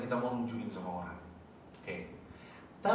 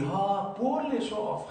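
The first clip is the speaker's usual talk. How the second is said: high, slow, drawn-out exclamation; stretched, raised celebratory call; gleeful proclamation; 8 semitones higher, 4 dB louder, slower than usual. Acted. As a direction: announce it with acted delight, pitched well up. Acts out permitted showing off.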